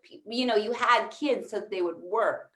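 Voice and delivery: ordinary delivery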